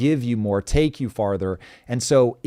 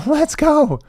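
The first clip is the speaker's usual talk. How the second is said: excited cheer; 8 dB louder than usual. Real, not acted. Hyped stream start cheer.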